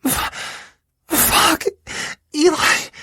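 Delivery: very high breathless cry of pleasure; climax cry. Acted. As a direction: gasp it out at the peak. Partner climaxing in bed.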